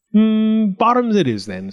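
high musing hum, then easy agreement; lightly sly farmer; hiding a smile. A narrator's reading. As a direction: agree with a touch of secret satisfaction. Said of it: "hiding a smile".

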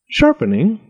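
high, lilting innocent question; cheerful farmer; cheery. A narrator's reading. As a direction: echo it brightly, as if unbothered. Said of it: "said the farmer cheerily".

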